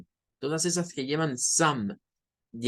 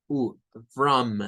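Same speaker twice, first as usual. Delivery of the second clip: ordinary delivery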